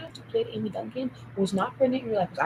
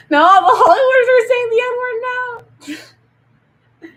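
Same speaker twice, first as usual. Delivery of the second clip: high, loud, slow words broken by laughter; laughing outburst; 13 semitones higher, 12 dB louder, much slower than usual. Real, not acted. Shocked by what Hollywood says, laughing loudly. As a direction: laugh through the line in disbelief.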